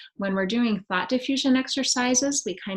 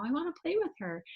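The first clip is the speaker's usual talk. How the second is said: put-on higher, quicker little-girl voice; child's voice lightly imitated; 8 semitones higher, 5 dB quieter, faster than usual. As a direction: lightly chirp it like a small child. Teacher voices the child's answer.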